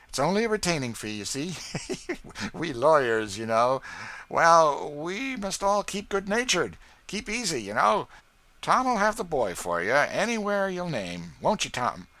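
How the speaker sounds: butter them up with a chuckle; oily lawyer. Acted. Marks the smooth lawyer.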